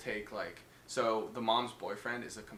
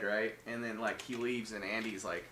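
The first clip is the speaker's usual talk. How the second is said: normal talking voice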